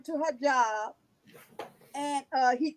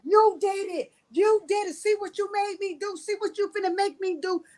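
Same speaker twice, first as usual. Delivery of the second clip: higher, louder mock accusation; imitated blaming rant; 7 semitones higher, 3 dB louder than usual. Father on video blaming the kids' mother, imitated angry rant. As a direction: throw the blame like an enraged father. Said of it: "he was cussing".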